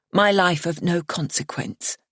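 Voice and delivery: ordinary delivery, nothing marked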